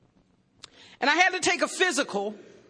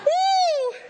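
whoop; 4 dB louder than usual. Real, not acted. Cheer after laughter.

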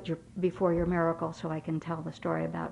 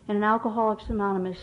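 ordinary talk, nothing marked

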